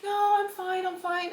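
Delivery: high-pitched fake reassurance; insisting she's fine. Telling his sister nothing is wrong, high mock-brave denial. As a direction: pitch it up, brushing off concern.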